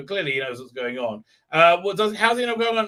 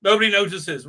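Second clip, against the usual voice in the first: usual speaking voice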